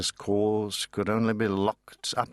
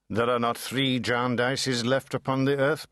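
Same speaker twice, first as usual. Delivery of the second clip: ordinary delivery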